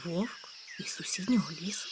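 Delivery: a touch of unease at the wolf; slightly uneasy. Acted. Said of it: "трохи збентежившись". (трохи збентежено, тихіше)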